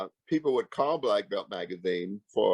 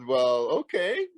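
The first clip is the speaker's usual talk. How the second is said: slow, higher okay, lightly put-on older boss voice; imitating the boss; 8 semitones higher, slower than usual. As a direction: stall slowly like a boss covering that he forgot. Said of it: "He goes".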